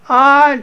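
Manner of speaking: high, loud shriek of surprise; startled scream. Retold or imitated. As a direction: scream like a coyote just jumped out at you. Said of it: "she, she hollered".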